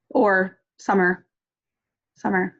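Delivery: ordinary delivery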